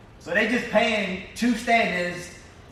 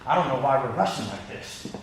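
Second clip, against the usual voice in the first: understated imitation of a frustrated grumble, lower in pitch; retold frustration; 4 semitones lower than usual. PA walking by Video Village complains about rushing, mildly frustrated. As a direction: lightly act out a fed-up assistant, pitched down. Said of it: "he's frustrated".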